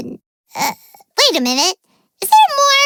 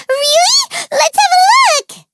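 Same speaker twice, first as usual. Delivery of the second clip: higher, louder eager cry; thrilled; 9 semitones higher, 3 dB louder than usual. Real, not acted. Paimon hears a gift was prepared, with a thrilled loud cry.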